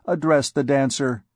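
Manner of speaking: ordinary delivery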